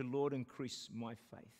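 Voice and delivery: slow, reverent personal plea; slow solemn prayer. Shown as a demonstration. Preacher changing the verse to a personal prayer. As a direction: linger on the words, make it yours. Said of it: "I want to change that to".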